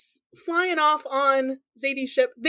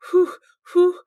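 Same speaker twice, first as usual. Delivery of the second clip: two sharp blown-out breaths to steady nerves; nervous steadying breaths; 4 semitones higher, 6 dB louder than usual. Retold or imitated. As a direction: blow out air, psyching yourself up. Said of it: "She's psyching herself up".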